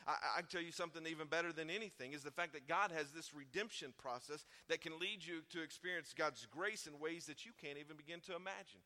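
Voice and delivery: ordinary talk, nothing marked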